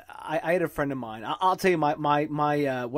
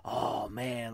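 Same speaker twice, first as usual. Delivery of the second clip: imitating a slow, dazed drawl, a bit lower and softer; slow spaced-out friend; 4 semitones lower, 8 dB quieter than usual. Imitating the Florida friend's slow spaced-out talk, lightly voiced. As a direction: mimic the friend who talks very slowly. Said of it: "like, very slowly".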